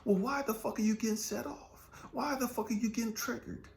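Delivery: high, urgent preaching challenge; impassioned challenge. Real, not acted. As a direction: demand it of the crowd. Preacher demanding answers.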